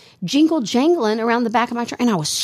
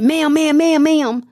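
high, louder repeated calling of ma'am; calling after her; 8 semitones higher, 4 dB louder, much slower than usual. Retold or imitated. Store worker runs after the customer, calling out ma'am. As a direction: call ma'am after a woman walking away.

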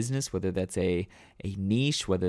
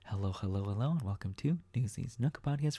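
softer, murmured to oneself; quiet mantra; 7 dB quieter than usual. Shown as a demonstration. Driver calming himself on the way to work, softly. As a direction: say it under your breath to calm down. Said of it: "use it as a little bit of a mantra".

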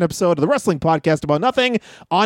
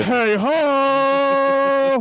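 long held catchphrase, pitched higher; Hacksaw Jim Duggan; 5 semitones higher than usual. Acted. Host in speed round, long drawn-out higher mock impression. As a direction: hold the last word long, mock wrestler style. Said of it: "Hacksaw Jim Duggan".

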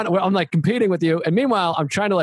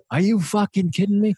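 mildly indignant, higher pitch; mock-offended drinker; retold anger; 4 semitones higher than usual. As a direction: act out being insulted. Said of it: "I remember getting mad".

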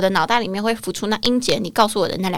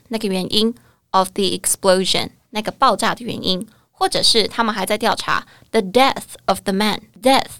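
normal talking voice

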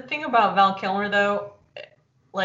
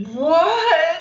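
higher, louder startled shout; startled what; 8 semitones higher, 6 dB louder than usual. Retold or imitated. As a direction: jump out of your skin. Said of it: "so I was like".

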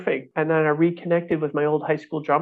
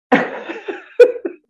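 high laugh; 5 dB louder than usual. Real, not acted. Laughing at his failure.

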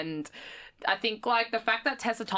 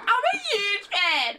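mock fan yell; 10 semitones higher, slower than usual. Guest replays her blunder for laughs, high mock fan yell. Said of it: "I yelled at her".

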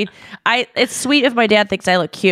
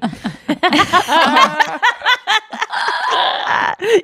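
breaks into a high shrieking laugh; high burst of laughter; 12 semitones higher than usual. Real, not acted. Laughing at her own creepy dad joke, high cackle.

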